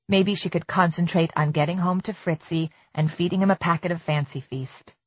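plain reading voice

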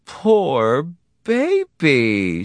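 high, very drawn-out sympathetic coo; consoling wife. Acted. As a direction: coo over him slowly, as his wife. Wife consoles suffering husband.

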